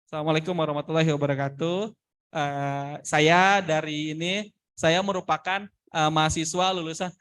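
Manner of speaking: low mumbled greeting, then halting introduction; unprepared speaker. Shown as a demonstration. Trainer imitates a student not ready to present, unprepared and hesitant. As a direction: rush the greeting, then hesitate over who you are. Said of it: "ini gestur orang yang nggak siap".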